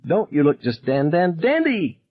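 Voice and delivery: high, gleeful mocking bully; cheerful cruel taunt, pitched high; merry taunting. A narrator's reading. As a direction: relish the stammered dandy. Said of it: "Dickie Cable cried merrily".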